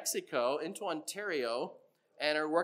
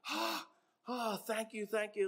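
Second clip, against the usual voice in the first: relieved worker; 5 semitones higher, 5 dB quieter than usual. Retold or imitated. Relieved worker thanking boss.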